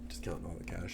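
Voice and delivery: hushed mock whisper to oneself; barely voiced, furtive mutter. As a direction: whisper it very softly. Acts out the mom sitting there.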